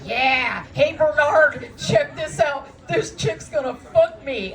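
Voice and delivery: guy calling to his buddy Bernard, loud and smug; loud bragging guy. Retold or imitated. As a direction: brag across the room like a frat guy. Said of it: "he's like".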